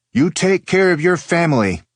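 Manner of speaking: put-on high, loud older aunt voice; aunt's voice. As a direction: voice the aunt firmly. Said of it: "his aunt telling him".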